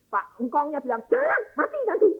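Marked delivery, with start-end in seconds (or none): 1.1-2.1 put-on much higher, pinched old man's warning; old grandfather forbidding. Grandfather forbids the sick child to be laid on the ground, high and insistent. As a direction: warn the child off like a fussy grandpa.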